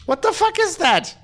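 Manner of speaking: high incredulous what the fuck. Real, not acted. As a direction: pitch up in disbelief. Incredulous rant outburst.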